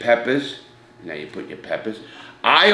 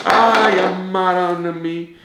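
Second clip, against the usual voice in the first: overjoyed by his cooking; delighted exclamation; 7 semitones higher, slower than usual. Real, not acted. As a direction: gasp with joy at the smell. Savoring the food he cooked.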